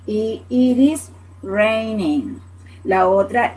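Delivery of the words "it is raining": it is raining said slowly, NG sounded at the end; slow demo. Shown as a demonstration. Teacher modeling the full phrase, slowly. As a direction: draw out it is raining word by word. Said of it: "Y N G al final".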